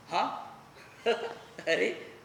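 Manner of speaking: ordinary talk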